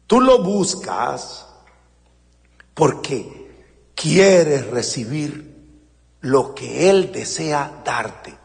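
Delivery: ordinary delivery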